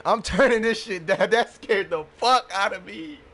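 laughing through the words; relieved laughter. Real, not acted. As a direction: still laughing from the fright. Laughing after jump scare.

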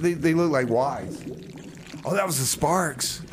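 normal talking voice

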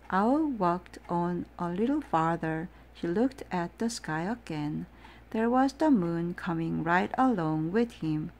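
ordinary delivery

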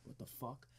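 very soft, baffled under-the-breath question; retold baffled aside. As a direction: say it softly, as if privately puzzled. Retells friend's silent confusion.

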